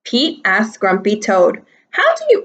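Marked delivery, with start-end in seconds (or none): 1.9-2.4 high squeaky question. Acted. Pete asks, voice jumps.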